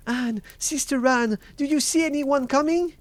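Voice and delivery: very high, loud anxious call; afflicted cry. A narrator's reading. Afflicted wife calling up to Sister Anne, high anxious cry. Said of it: "afflicted wife cried out".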